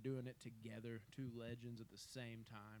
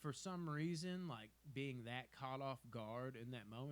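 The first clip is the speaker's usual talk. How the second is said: usual speaking voice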